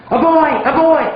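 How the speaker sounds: high, eager shouted answer; mock student shout. Student shouting the answer instantly, high and eager. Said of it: "instantly shout".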